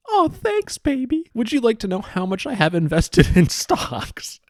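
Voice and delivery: high, hammed-up gushing scammer voice; mock sugar-mama voice. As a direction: put on a silly high-pitched sweet-talk. Voicing scammer chat in mock role.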